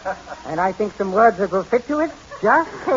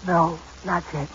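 soft, low, strained whisper; hushed laryngitic reply; whispering from laryngitis; 3 semitones lower, 7 dB quieter than usual. Acted. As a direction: barely get it out, voice gone. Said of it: "why are you whispering?".

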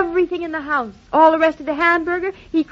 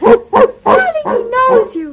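high, bright burst of delight; 8 semitones higher, 5 dB louder, much slower than usual. Acted. Wife thrilled the dog greets her husband, high and excited. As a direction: gush with joy that the puppy recognises him.

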